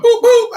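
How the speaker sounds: high, loud mock boop boop; imitating boop. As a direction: chirp it up high like her. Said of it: "She be like".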